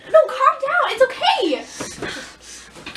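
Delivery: high-pitched staged plea; hammed-up, last words drawn out; frantic pleading. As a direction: try to calm him, panicking. Skit, pleading with dad.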